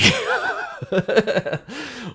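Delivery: high, long helpless laugh; high cackle. Real, not acted. Reviewer cracks up at the orgy scene: long high laugh. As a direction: crack up at your own joke. Laughs at his own retelling.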